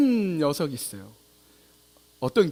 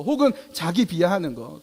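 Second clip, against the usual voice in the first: ordinary delivery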